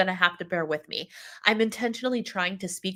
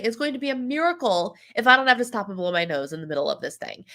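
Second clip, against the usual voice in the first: ordinary delivery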